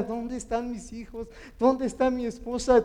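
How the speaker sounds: high-pitched, desperate retold questioning; retold desperation. Preacher reliving the search for his family, desperate. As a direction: relive a frantic search for loved ones. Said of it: "corrí desesperado y decía".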